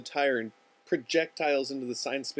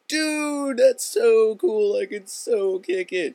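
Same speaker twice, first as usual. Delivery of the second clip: loud, high, drawn-out dude gushing; hammed-up ecstatic buddy; thrilled; 10 semitones higher, 9 dB louder, much slower than usual. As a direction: gush slowly and loudly as if it's the best thing ever. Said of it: "It's like the best thing ever to him".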